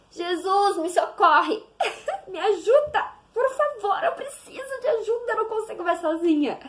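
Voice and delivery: pleading prayer for help, half laughing; desperate plea. Retold or imitated. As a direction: beg Jesus for help, near tears. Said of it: "É só pedir ajuda".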